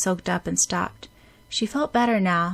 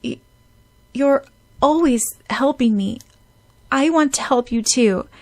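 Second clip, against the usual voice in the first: tearful schoolgirl trying to be brave; young girl holding back tears; 3 semitones higher, 3 dB louder than usual. Acted. Sakura's voice, near tears.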